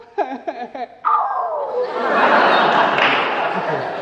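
stylized wailing sobs ending in a hooked gasp; mock sob in three ha's, then hook it up; hammed-up mourner's sobbing flourish. Shown as a demonstration. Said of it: "非得有这三哈哈一勾".

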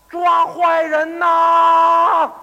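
drawn-out, high, very loud shout; 大喊呼救. Acted. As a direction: call down the alley for help, stretching the last word. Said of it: "有人直喊". (拖长声高喊)

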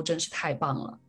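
usual speaking voice

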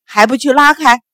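loud, forceful command to servants; sharp commanding shout; barked order. A narrator's reading. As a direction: bark at the servants to act. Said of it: "喝道".

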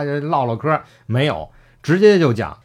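usual speaking voice

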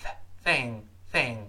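"thing" starting on a hissed th through the teeth; careful th in "thing", tongue tip out; tongue-between-teeth th. Shown as a demonstration. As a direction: isolate the th, then say "thing". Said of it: "舌尖从上下牙之间露出一丁".

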